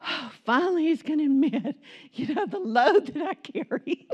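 hopeful words broken by laughter; laughing self-mockery. Wife mocking her own hope that her husband will pity her, laughing. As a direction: laugh at your own martyrdom. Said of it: "And I was like".

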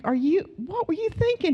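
imitating her shocked younger self; incredulous. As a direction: scold in disbelief. Her own incredulous reply.

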